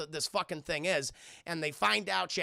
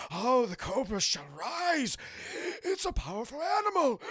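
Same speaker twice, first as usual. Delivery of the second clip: the Doctor; 4 semitones higher than usual. Retold or imitated. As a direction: savor it with overdone menace. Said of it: "he talks like this throughout the entire movie".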